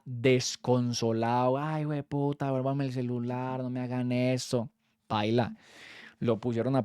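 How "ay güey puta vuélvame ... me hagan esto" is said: softer, slow whimpering plea; lightly acted sobbing classmate; mock crying plea. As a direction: imitate a kid begging for his phone. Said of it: "ese marica lloraba".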